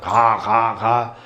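harsh mock crow caws; crow cawing. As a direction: caw like a crow. Imitates crows cawing.

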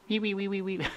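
high, squeaky dolphin chirps; imitated dolphin squeals; dolphin squeak. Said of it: "They talk like this".

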